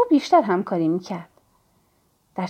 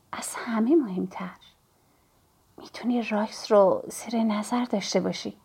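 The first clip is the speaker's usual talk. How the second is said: lowered, hushed confiding voice; murmured whisper; 6 dB quieter than usual. A narrator's reading. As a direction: drop your voice and confide it softly. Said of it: "صدایم را پایین آوردم و زمزمه کردم". (زمزمهٔ آهسته و محرمانه)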